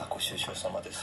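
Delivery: soft, low, almost voiceless sympathy; hushed condolence. Real, not acted. Listener told of the father's death, hushed condolence.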